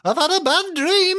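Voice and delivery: high, whiny biblical Jacob. Retold or imitated. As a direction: play Jacob waking from a bad dream. Voicing Jacob waking up.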